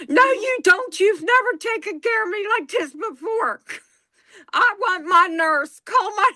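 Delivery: high whining mock protest at husband; whiny upset patient. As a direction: push him away like a cranky patient. Retold fight with husband as patient.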